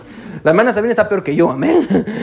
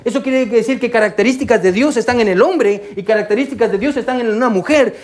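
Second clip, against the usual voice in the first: normal talking voice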